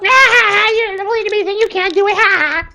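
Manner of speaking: very high, loud jeering cackle; mocking laughter. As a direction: squeal with scornful laughter at the little one. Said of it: "all the other creatures laugh".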